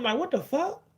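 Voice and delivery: usual speaking voice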